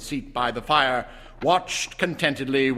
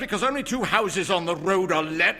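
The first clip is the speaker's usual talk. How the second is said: much higher, loud, rushing tirade; violent rant; 10 semitones higher, 4 dB louder, faster than usual. A narrator's reading. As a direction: rage at the place you live. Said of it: "with sudden and unlooked-for violence".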